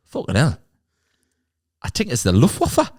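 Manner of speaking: Geordie lad rising high on Luftwaffe; startled Newcastle bloke spotting bombers; mock Geordie alarm; Geordie shock. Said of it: "he looks up and he goes".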